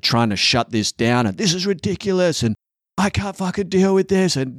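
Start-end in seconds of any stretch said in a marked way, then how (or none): 1.4-2.4 much higher exasperated quote; father losing patience with the kids, much higher; mock exasperation. Imitating his stressed parenting self. 3.0-4.4 much higher exasperated quote; father losing patience with the kids, much higher; mock exasperation. Imitating his stressed parenting self.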